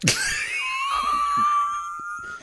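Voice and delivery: shrill squealing laugh; high shrieking laugh. Real, not acted. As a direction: burst into a screaming laugh. Big laugh at punchline.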